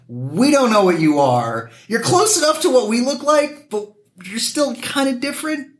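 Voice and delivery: lightly voiced locals, rising high at the end; a hint of confused onlookers; mimicking puzzled locals. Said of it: "It's like".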